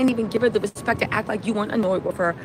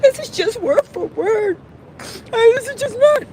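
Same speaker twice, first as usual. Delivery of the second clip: high, tearful mimicry of a woman; retold weepy plea, pitched way up; imitated crying; 9 semitones higher, 4 dB louder than usual. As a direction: act out her sobbing protest. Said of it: "She's crying, she's sobbing".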